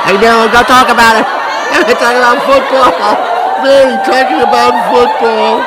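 high squealing laughter through words; can't stop laughing while talking; laughing hysterically. Real, not acted. Laughing fit about football.

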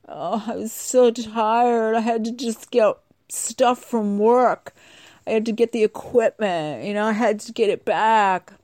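tired excuse. Retold or imitated. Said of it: "And the guy's like".

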